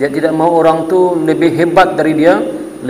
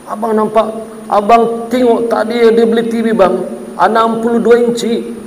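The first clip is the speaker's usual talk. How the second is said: envious wife; 6 semitones higher than usual. Retold or imitated. Wife envious about neighbour.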